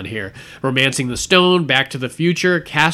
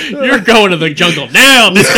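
laughing movie quote; 6 semitones higher, 8 dB louder than usual. Pretend. Quoting a movie line, laughing.

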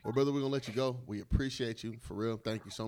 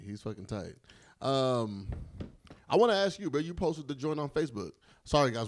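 normal talking voice

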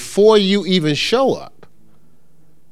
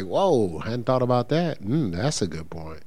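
hushed, low musing to oneself; locked-in listener; 5 semitones lower, 11 dB quieter than usual. Acted. Imitating a silent listener thinking it over, quiet musing. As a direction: take it in softly, thoughtfully. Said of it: "They just may be locked in like".

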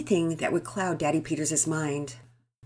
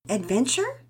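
curious; 6 semitones higher than usual. A narrator's reading.

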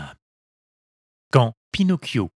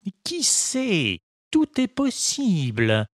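put-on slow, calm and much higher old father; 8 semitones higher, slower than usual. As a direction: gently repeat it, unhurried. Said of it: "répéta Geppetto calmement".

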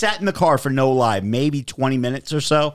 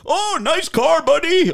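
much higher, louder cheerful mock voice; the escort praising his car, imitated much higher and louder; chatty attendant; 4 dB louder than usual. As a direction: do the guy gushing over the car.

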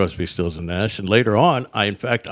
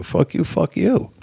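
mildly heated comeback, pitched up and drawn out; retold swearing match; 8 semitones higher, slower than usual. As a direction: act out trading insults, higher and slower. Retold quarrel with the roadie.

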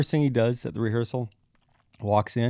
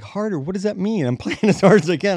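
high, exasperated protest; incredulous; 9 semitones higher, 7 dB louder than usual. Retold or imitated. Bassist protesting mid-song, high and incredulous. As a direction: splutter in disbelief, laughing through it.

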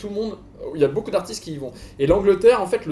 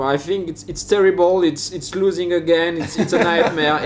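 English commentator; 4 dB louder, slower than usual. Retold or imitated. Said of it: "c'est quelque chose comme".

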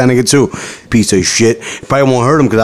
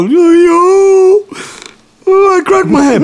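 put-on whiny hurt kid; slower than usual. Boy telling his mom he cracked his head, high whiny wail. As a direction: play yourself as a little kid wailing about your head. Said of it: "I go".

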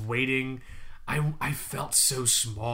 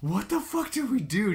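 panicked; 7 semitones higher, 4 dB louder than usual. Retold or imitated. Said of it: "I'm like".

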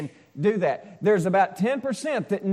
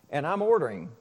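ordinary talk, nothing marked

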